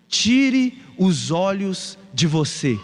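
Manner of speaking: very slow, weighty plea; slow appeal. Acted. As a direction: draw out each word of the plea. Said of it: "preacher's slow appeal". (apelo bem lento e solene)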